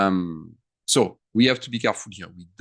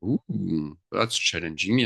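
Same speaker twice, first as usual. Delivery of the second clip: usual speaking voice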